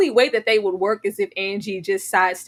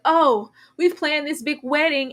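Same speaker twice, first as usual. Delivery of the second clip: mock cliche line; 5 semitones higher, 4 dB louder than usual. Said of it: "cliche where it's like".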